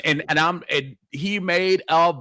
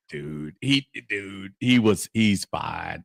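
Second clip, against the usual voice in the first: mock soothing; 10 semitones lower, 5 dB quieter, slower than usual. Mock reassuring low voice.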